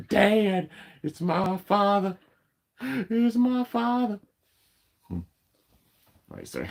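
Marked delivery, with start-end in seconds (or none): 0.1-4.2 very high, slow excited dog voice; be the dog, thrilled its dad is home; squeaky slow pet voice gushing over its father; voicing the dog. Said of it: "He's like".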